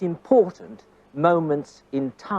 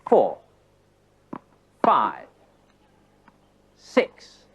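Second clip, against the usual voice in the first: FOUR, FIVE, SIX each punched out alone, falling sharply; emphatic counting; 5 semitones lower, much slower than usual. Shown as a demonstration. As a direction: call each number on the moment of impact. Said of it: "Emphasis on the moments".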